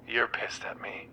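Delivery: hushed, wounded whisper; whispering to a friend who's mad at her. Acted. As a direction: breathe it out, barely voiced. Hurt complaint to friend.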